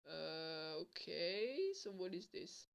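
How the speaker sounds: very soft, unsure retold reply; half under the breath, doubtful; wary hesitation. He answers the girl's promise to show something, warily.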